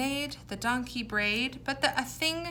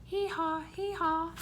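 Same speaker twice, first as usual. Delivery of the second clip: high, ringing hee-haw twice; donkey bray; 8 semitones higher than usual. Shown as a demonstration. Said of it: "This is what it sounded like when the donkey brayed".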